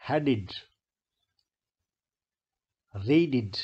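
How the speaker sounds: "headed", "raided" each ending in "id". Shown as a demonstration. Teacher models d-sound examples, -ed pronounced "id". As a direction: give "headed" and "raided" a clear second syllable "id". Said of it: "तो इट बोला जाएगा".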